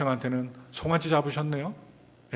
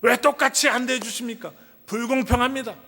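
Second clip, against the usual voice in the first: higher, wounded protest to the father; aggrieved elder son; 6 semitones higher than usual. Acted. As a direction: complain it isn't fair, like a hurt eldest son. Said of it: "불공평하다는 거예요".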